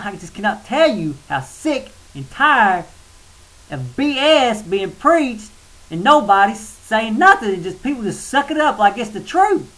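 venting real frustration, pushing hard; fed up with false preaching, worked-up rant; real irritation. Real, not acted. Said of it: "Sorry, I'm".

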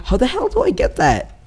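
high-pitched, incredulous question; frustrated. Real, not acted. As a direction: squeak it out in frustration. Frustrated at game puzzle.

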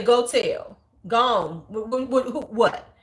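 loud, punchy challenge with a stammered taunt; defiant dare. Acted. Teacher calling a threatening student's bluff, defiant dare. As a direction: call their bluff loudly. Her own imagined response to student.

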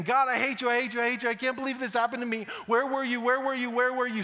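hurt, accusing wail at God; anguished outcry at God. Acted. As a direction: cry out at God like a wounded child. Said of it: "beating on his chest and saying".

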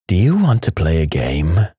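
low and menacing horror-movie line, not truly whispered; creepy mock whisper. A narrator's reading. Teen hiding behind the sofa to scare brother, creepy low voice. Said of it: "I whisper".

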